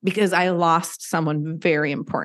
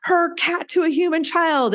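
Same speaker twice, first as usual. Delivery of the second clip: mock outraged readers; 11 semitones higher than usual. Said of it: "they were like".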